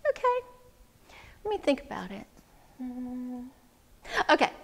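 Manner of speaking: soft, halting musing; pondering. Acted. Wise woman considering the man's plea, pondering softly. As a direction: think it over quietly with long pauses.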